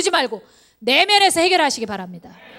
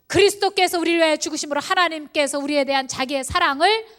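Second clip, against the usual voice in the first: ordinary talk, nothing marked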